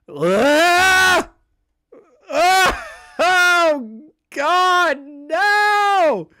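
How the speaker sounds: high, loud wail of horror; horrified outburst. Real, not acted. Streamer recoiling from creepy messages, horrified wail. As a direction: cry out as if it's unbearable.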